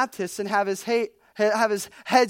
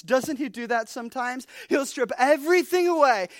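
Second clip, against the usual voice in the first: ordinary talk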